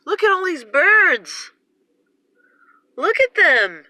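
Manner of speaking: high, loud cries of alarm at the birds; alarmed exclaiming. Real, not acted. Filming the flock overhead, shouts in high-pitched alarm. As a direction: shout it out, stunned by the swarm.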